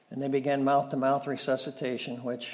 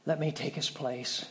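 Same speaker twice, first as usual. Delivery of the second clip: soft, falling, almost whispered echo; hushed repeat; 7 dB quieter than usual. Shown as a demonstration. Preacher echoing Judah's plea softly to the congregation. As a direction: let it sink in, quietly. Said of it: "at the loss of another son".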